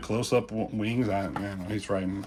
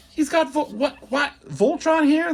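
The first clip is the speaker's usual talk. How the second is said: high-pitched squeal of fan disbelief; can't believe it, voice jumping way up; excited disbelief; 5 dB louder than usual. Real, not acted. Fanboy shock at Voltron.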